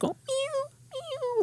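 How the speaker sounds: very high, soft meowing kitten voice. Retold or imitated. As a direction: mew like a tiny kitten. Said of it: "it's like".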